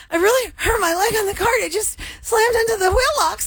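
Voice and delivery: high pitched retelling. Retold or imitated. Host retelling what she told store staff, high pained voice. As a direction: replay the hurt plea in a high thin voice. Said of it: "I was like".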